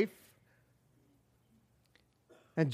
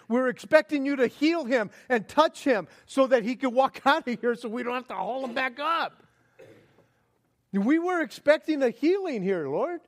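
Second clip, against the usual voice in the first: mild mock shout; 5 semitones higher, 4 dB louder than usual. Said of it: "maybe shouted to him".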